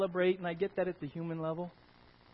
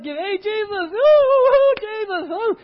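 high, loud whooping cheers; hammed-up celebrating; hooting and hollering; 13 semitones higher, 13 dB louder than usual. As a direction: holler at Jesus in triumph. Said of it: "They're like".

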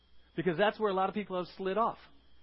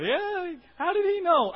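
higher, loud mock reaction; imitating congregation members feeling caught, higher and loud; mock congregant; 10 semitones higher, 9 dB louder, slower than usual. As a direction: play the caught-out listener. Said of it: "Y'all have".

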